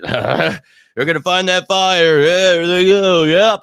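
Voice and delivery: mock the hopeful fans. Vlogger mocking hopeful fans, sarcastic loud cheer. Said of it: "I, wait".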